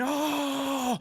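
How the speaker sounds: long, high "oh" of awe; high-pitched imitation of an amazed crowd; imitated crowd gasp. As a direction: mimic a stadium's drawn-out gasp. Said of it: "everyone in the stadium went".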